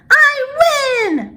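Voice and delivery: high delighted squeal; old woman cheering her dreidel win; gleeful win. Acted. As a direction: crow over the win. Wins dreidel game.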